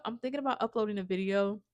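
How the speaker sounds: ordinary talk, nothing marked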